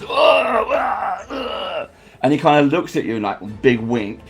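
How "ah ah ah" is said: high fading fake cries; theatrical dying wails; hammed-up dying groans. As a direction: ham up a stage death, fading out. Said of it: "makes a whole kind of big flourish".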